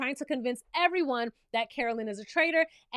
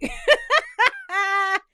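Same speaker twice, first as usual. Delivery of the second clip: laughs out loud, high and hearty; loud burst of laughter; 6 semitones higher, 6 dB louder than usual. Real, not acted. Recapper bursts out laughing at Danielle's guess. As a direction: crack up loudly at the bad guess.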